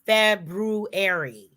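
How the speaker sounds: February with the first R sounded, FEB-roo-air-ee; slow, deliberate February, every syllable separate; careful pronunciation. Shown as a demonstration. Cohost teaching listeners the month's name, careful pronunciation. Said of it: "the month is called".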